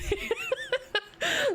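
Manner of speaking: very high falling laugh. Real, not acted. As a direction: laugh hard at the joke. Laughs at pregnancy joke.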